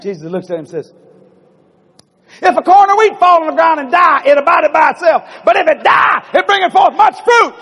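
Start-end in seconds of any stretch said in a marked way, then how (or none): 2.4-7.5 high, loud shouted scripture; booming proclamation. Acted. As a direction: thunder it out like a prophetic pronouncement. Acting Jesus's booming, cryptic pronouncement.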